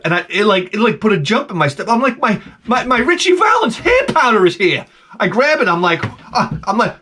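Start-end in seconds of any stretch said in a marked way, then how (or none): 2.2-4.8 giddy shout finding the package on the doorstep; giddy excitement. Retold or imitated. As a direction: burst out with joy at the package.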